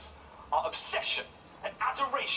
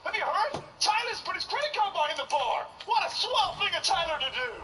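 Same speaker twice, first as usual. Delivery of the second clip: high, upbeat American office voice; American accent; 9 semitones higher than usual. Retold or imitated. Coworker praising the boss's bar tab, high upbeat American accent. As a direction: gush like a cheerful American coworker. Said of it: "In America".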